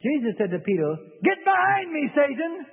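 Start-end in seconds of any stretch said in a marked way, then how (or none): 1.2-2.7 high, loud cry of rebuke; sharp rebuke. Retold or imitated. Jesus rebuking Peter, high and loud. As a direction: cast it off with force.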